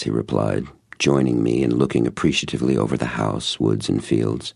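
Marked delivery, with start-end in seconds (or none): none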